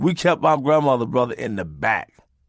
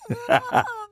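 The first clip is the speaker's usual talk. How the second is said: high laugh. Real, not acted. Laugh after punchline.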